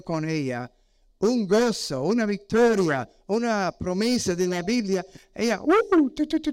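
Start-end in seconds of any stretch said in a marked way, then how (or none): 5.3-6.5 high mock beeping like a satellite; playful imitation of radar blips; radar beeps. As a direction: beep out the radar signal, high-pitched. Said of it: "como satélites, radar".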